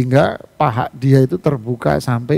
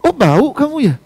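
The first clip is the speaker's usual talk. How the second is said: mocking retort; 10 semitones higher, 4 dB louder than usual.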